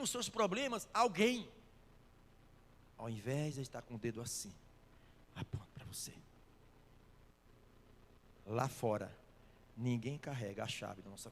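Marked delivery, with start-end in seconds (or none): 5.3-6.1 hushed, breathy whisper of a point; whispered aside to the congregation. Real, not acted. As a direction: lean in and whisper the accusation. Preacher points finger at listener.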